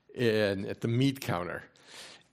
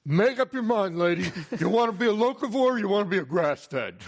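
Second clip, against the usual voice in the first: put-on brash, higher New York butcher accent, louder and fast; 8 semitones higher, 4 dB louder than usual. Butcher at the meat counter scolding the shopper in a New York accent. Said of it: "in this heavy New York accent said".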